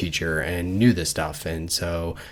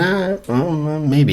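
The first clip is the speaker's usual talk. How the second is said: higher-pitched, hemming and hawing; hesitant hedging; 8 semitones higher, 4 dB louder than usual. Retold or imitated. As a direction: waver as if being talked into it. Retelling author's hesitant reply to son.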